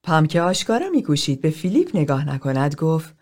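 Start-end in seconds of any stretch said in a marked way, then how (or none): none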